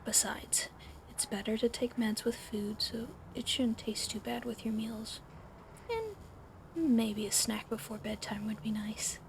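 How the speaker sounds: usual speaking voice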